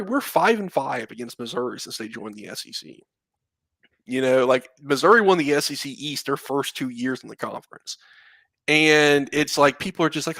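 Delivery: usual speaking voice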